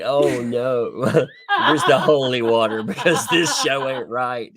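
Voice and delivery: loud laughing shout for holy water; hammed-up horror, laughing; laughing mock alarm; mock panic. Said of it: "You're like".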